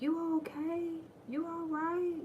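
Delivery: high sweet fake worry; sing-song innocent mom teasing her daughter; mock concerned mother. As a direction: ask sweetly, pretending not to know. Mock concern again, higher.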